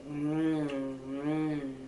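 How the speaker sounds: yummy mm. Shown as a demonstration. Teacher showing kids how eating sounds, soft yummy hum. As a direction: hum as if savoring food.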